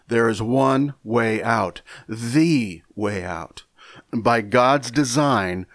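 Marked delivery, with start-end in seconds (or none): 2.1-3.6 the said slow and weighted, as in the one way out; slowly, with weight on the; stress on the. Shown as a demonstration. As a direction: lean on the, it means the only one. Stressing the definite article.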